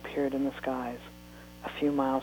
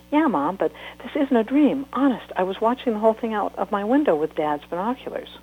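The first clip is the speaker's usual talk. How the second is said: higher, louder, pleading to be believed; insistent girl; 6 semitones higher, 4 dB louder than usual. Acted. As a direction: convince mom it's real. Child insisting to mother.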